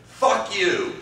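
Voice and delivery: loud, high angry retort. Retold or imitated. Partner in an improv scene shouting at you in anger. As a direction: snap it back in a shouted fight. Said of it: "in anger".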